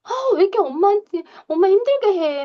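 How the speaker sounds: high, whiny parental complaint; exasperated giving in. Acted. Mother caving to child's tantrum, exasperated and complaining. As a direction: give in while grumbling, pitched well up. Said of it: "결국은 끌려가요".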